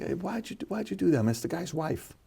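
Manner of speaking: talking a player down quietly; soft calm question. Retold or imitated. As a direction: hush it down like a quiet private word. Retold calm talk, soft.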